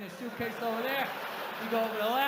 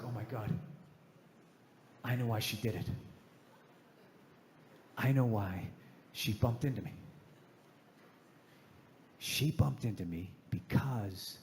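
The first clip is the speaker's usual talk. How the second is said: hushed revelation; 12 semitones lower, 3 dB quieter than usual. Acted. Realising at the sink why she did it, hushed awe. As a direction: let it dawn gravely, almost whispered at times. Acted slow revelation before punchline.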